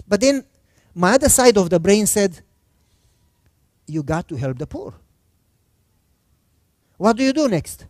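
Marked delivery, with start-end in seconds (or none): 3.9-4.9 low, soft inner voice; the quiet voice of conscience; hushed conscience voice. Retold or imitated. Other side of brain speaks.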